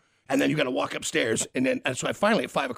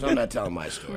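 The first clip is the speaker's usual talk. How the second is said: mock sulky guest; 10 semitones lower than usual. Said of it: "everybody else shuts down and goes".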